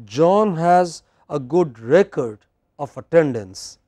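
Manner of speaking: slow model, phrase by phrase, gap after "record"; slow, relaxed reading with REcord, then a pause; pause before "of". Shown as a demonstration. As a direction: take your time, pausing before "of attendance". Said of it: "I'll say it again".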